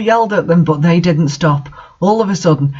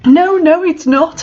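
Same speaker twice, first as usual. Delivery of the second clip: mock angry shout; 10 semitones higher than usual. A narrator's reading. Ebony shouting at Vampire in a shrill tantrum. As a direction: throw a teen tantrum. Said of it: "I shouted angrily".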